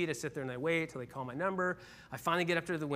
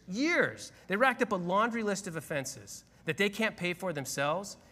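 higher, louder righteous protest; indignant plea; 5 semitones higher, 4 dB louder than usual. Retold or imitated. Man pleading with the DMV clerk, raised indignant voice. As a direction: argue your case at the window, rising. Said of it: "then I said this".

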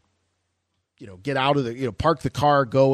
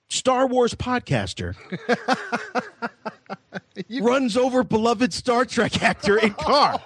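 mock headline; 9 semitones higher than usual.

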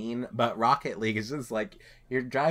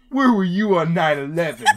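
loud, raised mock New York accusation; New York-Boston accent; 6 semitones higher, 8 dB louder than usual. Retelling friend's trash talk, loud New York-Boston accent. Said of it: "Quinn's New York, Boston, B M O accent".

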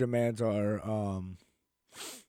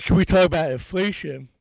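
reporter's shouted question; 5 semitones higher, 9 dB louder than usual. Retold or imitated. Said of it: "he's like".

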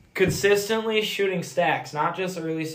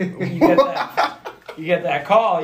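laughing through the words, louder; 2 semitones higher, 7 dB louder, slower than usual. Real, not acted. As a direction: chuckle as you say it. Laughing while telling the story.

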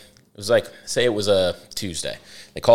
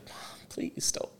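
slow mock whisper of begging; whispered plea; 16 dB quieter, slower than usual. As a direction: beg under your breath, voiceless. Kid begging mom, retold.

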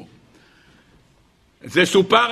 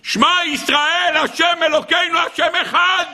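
retold desperate cry; 11 semitones higher than usual. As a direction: cry out a last prayer as the dying soldier. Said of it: "נתן צעקה". (זעקת תפילה נואשת בחיקוי)

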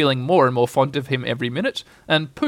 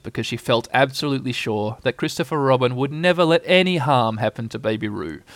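ordinary delivery, nothing marked

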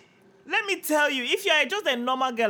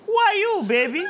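high, laughing challenge; mock challenge; 10 semitones higher, 5 dB louder than usual. To a man in borrowed slippers, mock challenge. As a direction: challenge him with playful disbelief.

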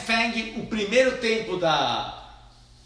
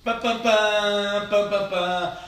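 slow chanted pam pam pam; mock fanfare; much slower than usual. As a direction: hum a little drum fanfare. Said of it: "mock drumroll fanfare".

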